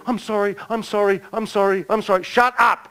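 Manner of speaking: high-pitched imitation of begging pardon; mock pleading apology. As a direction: act out frantic apologizing, then silence it. Re-enacts man's apology and his rebuke.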